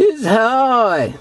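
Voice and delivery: drawn-out mock complaint; mimicking complainers, long slow vowels; imitated complaint. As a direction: stretch it out like someone complaining. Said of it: "You heard people say that all the time".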